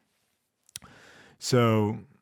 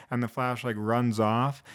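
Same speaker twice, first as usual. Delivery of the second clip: usual speaking voice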